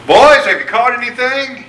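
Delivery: high, loud call across the water. Retold or imitated. Jesus calling to fishermen in the boat, loud and high. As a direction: hail the boat from the shore.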